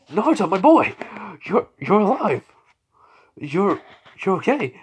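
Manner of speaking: high, loud cry of amazed relief; overjoyed astonishment. Acted. Hokage finding Naruto alive, overjoyed and astonished.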